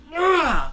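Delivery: high, loud startled yelp; startled cry. Retold or imitated. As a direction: yelp in surprise. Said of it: "he's like".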